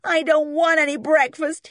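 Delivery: high, loud tearful whine; weeping. A narrator's reading. Beaten boy refusing breakfast, tearful wail. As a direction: wail it like a sulking child. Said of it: "he wept".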